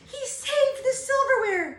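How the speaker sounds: high, loud delighted exclamation; joyful cry. A narrator's reading.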